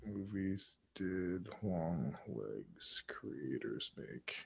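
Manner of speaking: reads it in a hushed whisper; breathy voiceless whisper, barely audible; reading under breath. Real, not acted. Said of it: "listen to [name] read under his breath".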